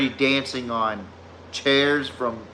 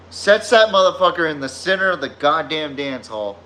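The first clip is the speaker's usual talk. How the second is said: ordinary delivery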